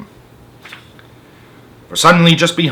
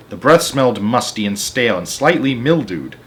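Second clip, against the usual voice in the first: plain reading voice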